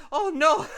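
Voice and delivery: high shrieking laugh-cry; mock wail. Photo frame wailing over lost kids, high and shrill. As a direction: squeal out a hammed-up sob.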